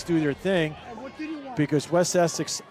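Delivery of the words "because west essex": normal talking voice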